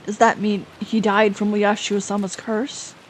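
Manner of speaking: ordinary delivery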